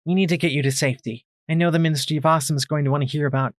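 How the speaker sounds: fast, rushing to get them out; urgent and quick; hurried urgent. Acted.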